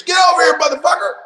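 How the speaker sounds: high, loud shouted greeting; booming excited welcome; joyful shout. Retold or imitated. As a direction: yell it like greeting an old buddy. Steven greets him loudly.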